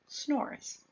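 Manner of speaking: whisper. A narrator's reading. Sleeping bear, hushed.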